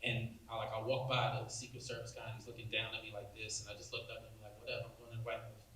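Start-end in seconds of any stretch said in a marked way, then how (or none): 4.6-5.7 quick, breezy, softer "whatever" aside; a muttered, cocky dismissal of the guard; defiant brush-off. Retold or imitated. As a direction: shrug him off in a rush. Retells own defiant inner reply.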